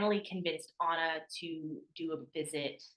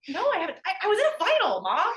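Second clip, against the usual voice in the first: much higher, louder flustered protest; exasperated daughter; 13 semitones higher, 8 dB louder than usual. Retold or imitated. Daughter to mom on the phone, high loud exasperated protest. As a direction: fire back at an overexcited mother.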